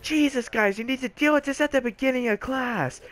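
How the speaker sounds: high-pitched mock scolding; imitating exasperated teacher. Teacher fed up over missing calculators, mock scolding.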